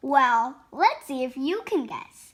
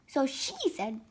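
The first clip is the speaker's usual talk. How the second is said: ordinary delivery, nothing marked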